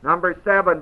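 normal talking voice

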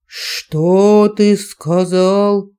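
very slow, otherworldly threat; menacing dragon voice. A narrator's reading. (медленная угроза дракона)

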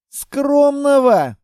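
high, loud, slow outraged echo; indignant. Acted. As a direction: draw out the word in disbelief. Outraged husband in staged quarrel.

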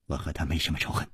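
low, quick, very soft reply; cornered man's hushed, hurried answer; subdued denial. Acted. Cornered man answers his interrogator.

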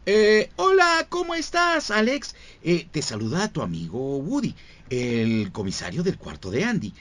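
high, friendly cowboy sheriff toy; slow and spooky on the warning; Woody voice. Acted. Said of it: "con la voz de Woody".

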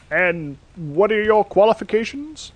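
Santa interviewing. Acted. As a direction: ask it like a weary Santa hiring staff.

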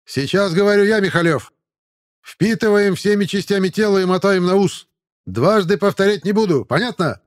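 loud, high barking sergeant; shouting drill sergeant. A narrator's reading. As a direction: bellow at the new recruits. Sergeant addresses recruits.

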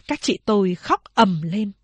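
ordinary delivery, nothing marked

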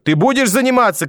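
very high-pitched furious cry; screaming demand. A narrator's reading. Grandmother screaming at grandson to study. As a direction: yell it as an order. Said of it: "кричала бабушка".

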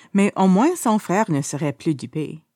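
ordinary delivery, nothing marked

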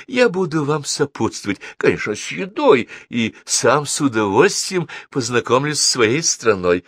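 keen high-voiced diplomat; excitable consul, effusive. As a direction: gush the offer happily.

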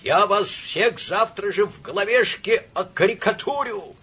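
put-on high-pitched drunken threat; loud, indignant vow of revenge; shouted threat. A narrator's reading. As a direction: yell it as you are dragged out. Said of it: "кричал сотрудник".

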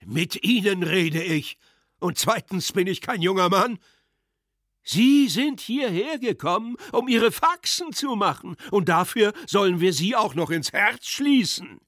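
put-on loud, high, scornful drunken rant; angry drunk tirade. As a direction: berate the general with drunken contempt. Drunk clerk berates the general. (wütende, betrunkene Schimpftirade)

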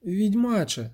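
ordinary delivery, nothing marked

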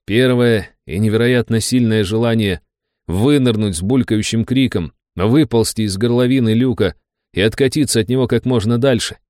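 ordinary delivery

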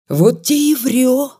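передразнить, чуть выше и иначе, чем свой голос; лёгкая насмешливая передразнивалка; передразнивая. A narrator's reading. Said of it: "передразнил калека". (Ванька передразнивает деда, насмешливо)

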